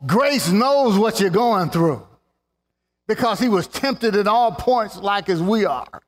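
full-force preaching; impassioned preaching. Acted. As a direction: press each truth home.

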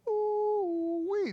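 high, delighted ooh-wee; excited whoop. Real, not acted. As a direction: cry out in pure delight. Preacher exclaims in delight.

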